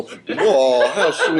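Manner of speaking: laughing, cooing at the puppy; mock awe. Actor mimicking the crew seeing the puppy, laughing. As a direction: coo it through a laugh. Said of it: "You go".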